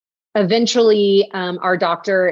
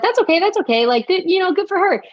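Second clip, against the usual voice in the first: much higher, sing-song fake brightness; high, chirpy put-on that hides the hurt; forced cheerfulness; 12 semitones higher, faster than usual. Retelling her reaction to her sister's pregnancy.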